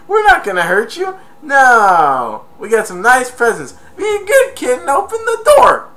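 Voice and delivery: high, loud, fake-sweet coaxing; coaxing burglar. Harry luring Kevin to the door, high fake-sweet call. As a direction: lure the kid out with false kindness. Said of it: "He, Harry goes".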